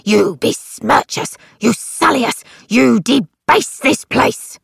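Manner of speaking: angry rebuke. A narrator's reading. Crux scolding in the chapel.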